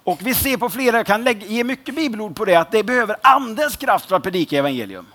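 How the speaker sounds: normal talking voice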